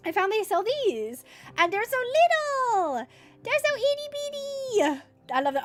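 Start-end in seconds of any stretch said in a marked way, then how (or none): 1.6-5.0 high, drawn-out cutesy squeal; cutesy gushing. Real, not acted. Streamer shows off little cans, slow high gushing. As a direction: stretch it out high over something tiny.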